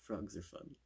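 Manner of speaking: ordinary talk, nothing marked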